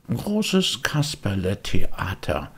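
drawn out like a showman's call; slow proclaiming read; slow announcing. Acted. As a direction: announce the poster grandly. Boy reads poster grandly.

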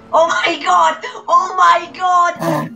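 loud high disbelief; excited exclaiming. Real, not acted. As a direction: shout it in disbelief. Police chase, exclaiming.

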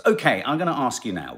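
ordinary delivery

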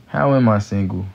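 usual speaking voice

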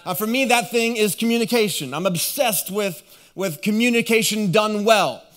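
high, energetic sermon delivery; impassioned preaching. Real, not acted. Preacher telling congregation about great communicators, lifted preaching voice. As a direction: preach it out to the whole room.

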